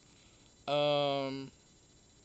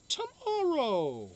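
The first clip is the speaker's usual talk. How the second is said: sing-song sign-off; 10 semitones higher, 4 dB louder, much slower than usual. Acted. Sign-off, drawn out.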